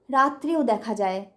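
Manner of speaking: ordinary delivery, nothing marked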